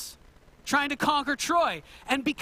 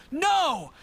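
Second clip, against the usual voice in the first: emphatic no; 5 semitones higher, 6 dB louder than usual. Real, not acted. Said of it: "teacher exclaims mid-story".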